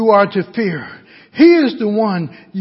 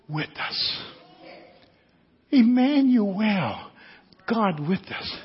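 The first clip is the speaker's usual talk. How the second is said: hushed preaching; 9 dB quieter than usual. Real, not acted. Preacher asking congregation to acknowledge God's presence, hushed. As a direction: leave long pauses and speak softly.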